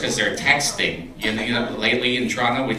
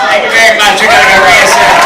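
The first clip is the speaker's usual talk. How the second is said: stern auditor; 7 semitones higher, 12 dB louder than usual. Pretend. Auditor voice sign-off.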